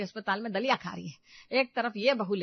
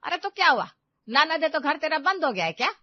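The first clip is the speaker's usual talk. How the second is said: louder, higher, scolding; indignant mother; 8 semitones higher, 9 dB louder than usual. Acted. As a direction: scold her for being so meek. (नाराज़ होकर डाँटती माँ)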